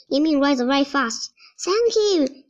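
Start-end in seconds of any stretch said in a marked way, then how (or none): 1.6-2.4 shouts. A narrator's reading. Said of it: "shouts Mulan".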